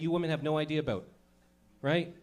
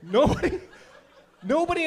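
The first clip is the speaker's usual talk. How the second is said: laughing, louder, higher each time; laughing repeat; 10 semitones higher, 5 dB louder than usual. Real, not acted. Repeating to the crowd, laughing hard. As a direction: crack up while repeating it. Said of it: "repeated, laughing and louder".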